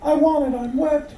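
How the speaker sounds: high, demanding, impatient wife; shrill wife voice. Acted. Wife calling to her husband in a high, demanding voice.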